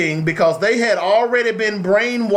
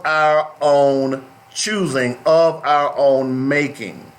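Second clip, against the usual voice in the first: "our own choosing" and "of our own making" stretched out slowly and lower; slow emphatic point; 3 semitones lower, slower than usual. Real, not acted. Driving home his point to listeners, slow and weighty. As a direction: land the point slowly, word by word. Closing point of a sermon-like talk.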